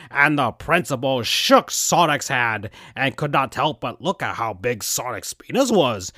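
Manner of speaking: plain reading voice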